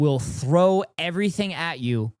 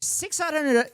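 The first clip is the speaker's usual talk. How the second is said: much higher, fast, fed-up disbelief; exasperated outburst; 11 semitones higher than usual. Real, not acted. Streamer yelling at the debater, high exasperated outburst.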